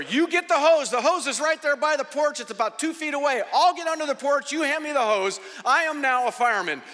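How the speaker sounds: high, loud acted commands; urgent orders. Man ordering Taylor to fetch the hose, urgent acted commands. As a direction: take charge like a self-appointed fireman.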